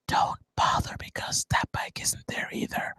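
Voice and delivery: hushed, voiceless stage whisper; whispered aside. Acted. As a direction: whisper it as a secret letdown. Aside deflating the excited viewer.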